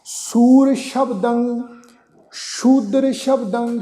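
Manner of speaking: slow, stretched phrase-by-phrase recitation; recitation demo. Shown as a demonstration. Teacher reads the verse for learners, slow recitation. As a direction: draw out each word and hold the endings. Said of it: "ਇਹ ਸ਼ਬਦ ਦਾ ਉਚਾਰਨ ਕਰਾਂਗੇ".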